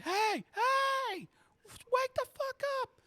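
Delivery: very high falsetto shout; high, urgent mock-female yelling; frantic Abby. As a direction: shriek at someone to wake up. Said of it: "you can hear Abby's like".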